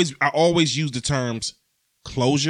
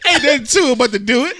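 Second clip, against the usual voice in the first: laughing; 10 semitones higher, 6 dB louder than usual. Real, not acted. Bragging nickname joke, cracking up.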